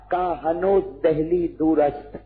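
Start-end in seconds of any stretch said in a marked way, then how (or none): none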